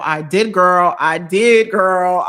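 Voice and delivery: loud, emphatic yes; excited. Real, not acted. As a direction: belt it out with joy. Enthusiastic reply to chat question.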